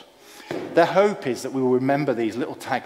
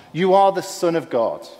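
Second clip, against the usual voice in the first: high, raised demons' cry; mildly hammed desperate outcry; desperate shout; 5 semitones higher, 4 dB louder than usual. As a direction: call out desperately, higher than usual. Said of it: "in their desperation, once again, they shouted out".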